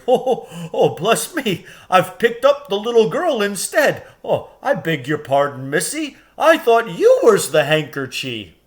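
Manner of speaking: high, chuckling giant voice; flustered giant. A narrator's reading. Giant fumbling Lucy, flustered and chuckling. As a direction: apologise with a laugh.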